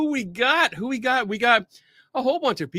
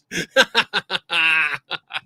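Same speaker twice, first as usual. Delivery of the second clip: cackling laugh; 4 dB louder than usual. Real, not acted. Laughter after greeting.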